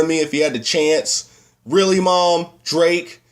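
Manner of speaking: high, incredulous mock outrage; exasperated disbelief. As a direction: confront your mom, appalled and drawn out. Acted disbelief at mom liking [name].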